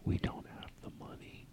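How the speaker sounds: hushed urgent whisper. Acted. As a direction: whisper it behind his back. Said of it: "I hear you guys whispering".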